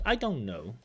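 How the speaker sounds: "don't know" said as "I don' know", the T swallowed; dropped t. Shown as a demonstration. Examiner repeats the assimilated "don't know" for the viewer. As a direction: blend "don't" straight into "know" with no T. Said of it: "That T is dropped".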